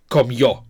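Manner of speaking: comió said "kom-YO"; weight on the final yo of comió; stress on the O. Shown as a demonstration. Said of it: "the stress is on the O".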